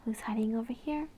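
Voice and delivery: quick playful hushed whisper; a secret peekaboo whisper to a little one. Acted. Reading a farm book to a toddler, playful whisper.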